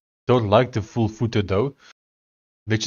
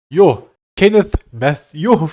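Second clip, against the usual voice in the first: slow, loud, higher Afrikaans-accented Kenneth Matthew; 5 semitones higher, 6 dB louder, slower than usual. Real, not acted. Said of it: "My mouth and my nose doesn't want to say it".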